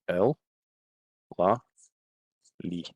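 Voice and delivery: "el", "la", "li" each said alone and spaced; past tense endings. Shown as a demonstration. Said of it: "we just use".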